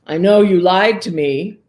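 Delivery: put-on angry ogre at little girl; ogre anger. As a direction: accuse her, voice rising.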